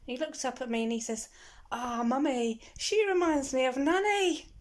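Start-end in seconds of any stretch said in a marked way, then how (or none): 1.7-4.5 put-on high, loud innocent child's voice; the boy chirping it out; little boy. The boy tells his mum about the guard, in a high, loud voice.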